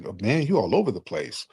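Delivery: ordinary delivery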